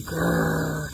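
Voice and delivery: playful puppy growl; long rolled grr; dog growl. Shown as a demonstration. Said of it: "He's growling".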